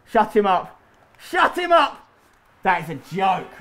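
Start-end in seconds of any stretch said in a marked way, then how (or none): 1.3-2.1 high, raised-voice demand; exclaimed high-pitched order; insistent shout. Real, not acted. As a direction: call it out more forcefully.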